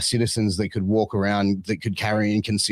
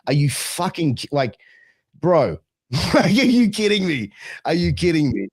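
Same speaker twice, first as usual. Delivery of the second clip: incredulous; 6 semitones higher than usual. Retold or imitated. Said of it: "I'm just like".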